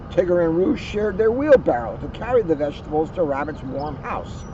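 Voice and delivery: ordinary delivery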